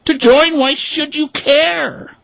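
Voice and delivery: high, slow puzzled ducks; high slow duck protest; exclaiming ducks. Acted. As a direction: ducks baffled by the hens. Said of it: "the ducks exclaimed".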